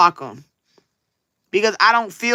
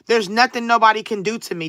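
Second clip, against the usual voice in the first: normal talking voice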